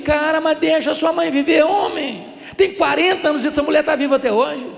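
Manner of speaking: high, loud, amused telling-off; laughing scolding. Retold or imitated. Pastor laughing while scolding the anxious seminarian. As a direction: laugh him out of his worry.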